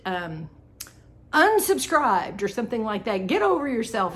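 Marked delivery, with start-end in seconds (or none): 1.3-2.4 drawn-out, higher and louder mock yell; imitating a viewer screaming. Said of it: "And she screamed it". 3.3-4.2 higher, louder dismissive jab; imitating a viewer's angry dismissal; mock yell at viewer. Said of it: "And she screamed it".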